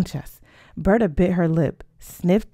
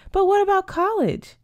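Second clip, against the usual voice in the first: high-pitched, alarmed question; dismayed protest; 13 semitones higher than usual. Acted. Sophia challenges her friend's marriage plan, dismayed protest. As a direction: object in a much higher voice.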